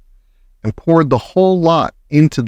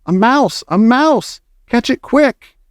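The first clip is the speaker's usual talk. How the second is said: high, urgent cries of alarm; shouting; 10 semitones higher, slower than usual. A narrator's reading. Kitchen staff calling to catch the mouse, shouting. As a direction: pitch up, urging the chase. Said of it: "they were shouting".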